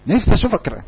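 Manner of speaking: a hint of a parent lamenting to their son; nagging parent. Parent wishing the son were a man, mildly nagging. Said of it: "طيب بابا وماما بقى".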